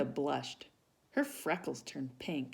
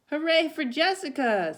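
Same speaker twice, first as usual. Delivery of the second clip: high, loud hooray; cheering; 12 semitones higher, 9 dB louder than usual. Acted. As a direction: cheer for her together. Family cheers.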